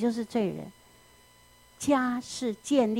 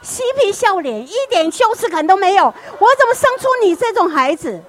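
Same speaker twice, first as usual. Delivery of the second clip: loud, much higher outraged scolding; disgusted parent; 9 semitones higher, 8 dB louder than usual. Acted. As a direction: spit out the shame. Parent scolding grinning child. (父母对嬉皮笑脸的孩子愤怒大骂)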